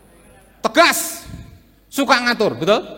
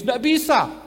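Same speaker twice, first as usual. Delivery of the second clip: normal talking voice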